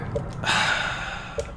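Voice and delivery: long, quiet breathy sigh; exasperated sigh. Real, not acted. Player before starting over, long exasperated sigh. Sighs after a failed run.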